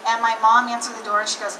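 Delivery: ordinary talk, nothing marked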